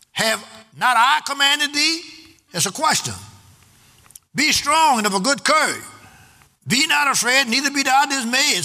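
high, ringing scripture read to the congregation; preaching at full force. Acted. Pastor thundering Joshua's charge at the congregation, full force. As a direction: proclaim it like a call to arms.

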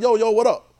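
loud, high casual street hello; lightly voiced street talk; street greeting. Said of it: "sometimes it's like".

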